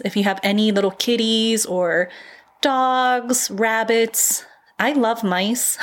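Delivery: ordinary talk, nothing marked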